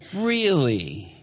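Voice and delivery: skeptical. Acted. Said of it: "And Peter's like".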